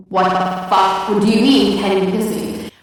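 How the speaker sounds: loud, incredulous outburst; outraged. Acted. Storyteller fumes at being asked for a kiss, outraged shout. Outraged reaction to retold question.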